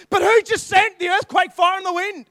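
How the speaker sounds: loud, high, building to a cry; preaching shout. Real, not acted. Preacher shouting to congregation about God's power, loud and high. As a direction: lift it up and shout it at the crowd.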